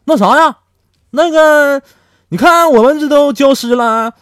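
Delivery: high, loud wheedling voice; cheeky request. Retold or imitated. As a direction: ask the abbot sweetly. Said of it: "转头那就跟方丈说".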